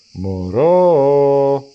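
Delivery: put-on a loud, drawn-out astonished baby?; the bunny, astonished at the baby, exclaims; surprised bunny. A narrator's reading. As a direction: stretch the word in loud surprise. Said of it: "είπε έκπληκτη η Λαγουδίνα".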